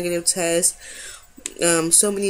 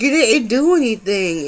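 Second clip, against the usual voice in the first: louder, higher incredulous reply; exasperated retort; 5 semitones higher, 6 dB louder than usual. Retold or imitated. To her apologising boyfriend, louder incredulous retort. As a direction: insist he did nothing wrong. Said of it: "I'm looking at him like".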